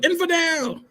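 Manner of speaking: very high-pitched heckler's cry; mock crowd yell. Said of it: "So the people start yelling".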